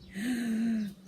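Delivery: sharp frightened gasp; sudden gasp of fear at the bird laugh; shocked gasp. Acted. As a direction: gasp in alarm.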